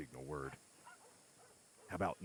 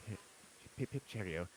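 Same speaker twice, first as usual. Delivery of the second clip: put-on posh British accent; 5 semitones higher than usual. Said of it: "Do it in your posh voice".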